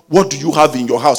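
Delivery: ordinary talk, nothing marked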